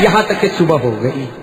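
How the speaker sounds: normal talking voice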